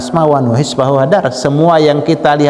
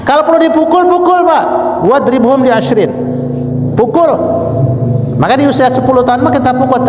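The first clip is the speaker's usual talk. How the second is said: high, urgent preacher's delivery; khotbah berapi-api; 9 semitones higher than usual. Real, not acted. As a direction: exhort them high and hard, easing briefly for the caveat. (ceramah tegas bernada tinggi)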